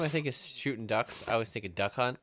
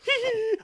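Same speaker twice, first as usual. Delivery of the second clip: very high mocking snicker of the Duck Hunt dog; imitated dog snicker; 5 dB louder than usual. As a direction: squeak out the dog's taunting laugh. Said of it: "Every time it goes".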